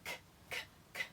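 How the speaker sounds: "K" as a whispered kuh; voiceless "K" sounds. Shown as a demonstration. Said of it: "Letter K".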